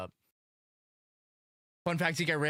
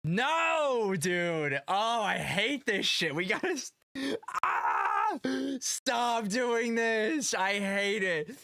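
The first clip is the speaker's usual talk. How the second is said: frustrated whine; 9 semitones higher, much slower than usual. Real, not acted. Upset about event timing.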